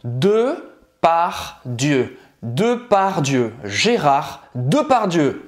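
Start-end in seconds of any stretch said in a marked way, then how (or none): 2.4-5.4 Depardieu said slowly in syllables, "dø par djø"; slow French name. Shown as a demonstration. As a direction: model De par Dieu slowly, then join it up. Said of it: "Agora é sua vez".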